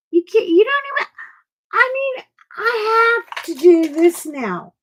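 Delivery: high, loud, near-crying whine of frustration; exasperated whine. Real, not acted. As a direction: wail it like you're at the end of your rope. Frustrated over tiny pictures.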